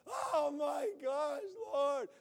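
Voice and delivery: loud, high, slow panicked wail; mock panic cry. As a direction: cry out like you're sinking. Panicking believer in the storm.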